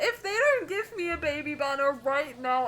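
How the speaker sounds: high, loud gushing with laughs and teary squeaks; excited squealing. Real, not acted. Gushing over the cute child.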